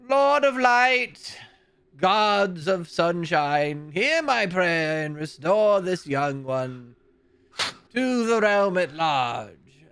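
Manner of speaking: high, slow incantation to the gods of light; solemn prayer. Acted. The abbot prays over the grave, high and slow. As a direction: intone it slowly like a priest invoking.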